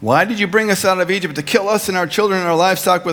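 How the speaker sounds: complaining Israelites. Acted. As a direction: voice the crowd blaming Moses. Quoting the complaining people.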